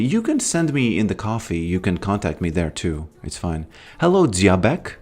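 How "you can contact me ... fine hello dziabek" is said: ordinary delivery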